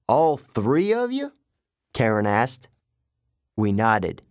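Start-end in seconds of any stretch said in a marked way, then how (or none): 0.1-1.3 put-on slow, higher British-like accent, sceptical question; strange British accent. A narrator's reading. Guard doubts the children's story, strange British accent. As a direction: query it slowly in an odd British accent. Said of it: "He had a strange accent".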